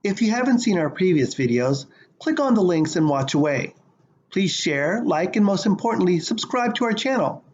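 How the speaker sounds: loud, projected channel outro; outro read. Acted. Host asking viewers to share and subscribe, projected outro read. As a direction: deliver a punchy subscribe call to viewers.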